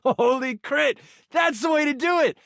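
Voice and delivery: excited cheer. Acted. Game master cheering the player's critical roll, shouting with joy. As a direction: cheer them on at full voice.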